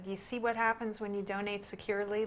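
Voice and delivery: very soft, hushed delivery; whispering. Retold or imitated. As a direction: say it under your breath. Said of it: "you're gonna have to quit whispering".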